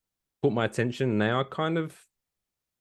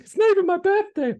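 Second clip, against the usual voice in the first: high and loud mock old woman, stressing not; indignant granny squeak; old lady voice; 6 dB louder than usual. Old lady protesting it's not her birthday.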